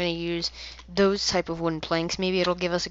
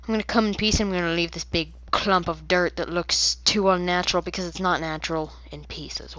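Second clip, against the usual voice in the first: usual speaking voice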